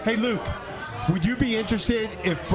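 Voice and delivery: usual speaking voice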